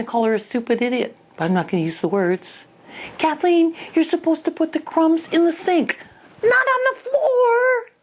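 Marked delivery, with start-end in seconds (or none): 3.2-7.9 shrill, much higher and louder telling-off; scolding mother. Retold or imitated. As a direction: nag like an exasperated mother. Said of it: "I jumped into my mother".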